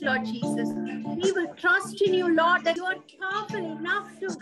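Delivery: normal talking voice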